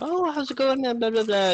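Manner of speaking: high-pitched barman's greeting; chatty barman. Acted. Half-elf chatting to other patrons, high put-on voice.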